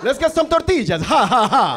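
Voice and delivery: high, loud vampire voice with a staged laugh; mock vampire. Comic mocking his friend's Spanish, loud hammed-up vampire. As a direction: ham it up like a vampire, "ha, ha, ha". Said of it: "like a vampire".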